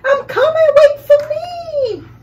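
high, loud, desperate call after the boat. Acted. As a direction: shout after it as it floats away. Boy calls after his boat.

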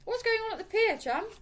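high, loud call to a passer-by. A narrator's reading. Man hailing a passing stranger about the pier, calling out. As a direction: call out loudly, pitched right up. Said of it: "He called out to a man".

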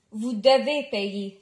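low, slow, weighty demand; firm insistence. A narrator's reading. Cashier insists dad must pay, firm demand. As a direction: lay it down slowly and firmly.

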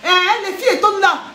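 normal talking voice

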